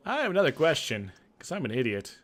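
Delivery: loud, high, fast exasperated ask; blurted question. Acted. Player to the NPC, a loud baffled blurt.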